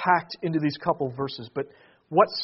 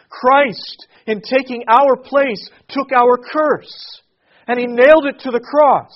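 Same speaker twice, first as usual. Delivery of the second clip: higher, much louder climactic preaching; preaching at full force; 6 semitones higher, 9 dB louder than usual. Acted. Pastor telling congregation Jesus fulfils Israel's hope, preaching full force. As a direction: build to a ringing proclamation. Sermon climax on Christ's work.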